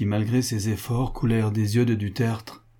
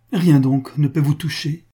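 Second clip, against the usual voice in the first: higher, louder outcry; exclaiming; 6 semitones higher, 8 dB louder than usual. A narrator's reading. Charles appeals to a pitiless Monsieur Pascal, exclaiming. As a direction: cry it out in appeal. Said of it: "s'écria Charles du Tertre".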